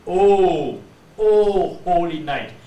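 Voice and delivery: long, loud, fully rounded O. Shown as a demonstration. Teacher showing how it should sound, long round O. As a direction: hold the O out with a round mouth. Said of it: "your mouth is very round".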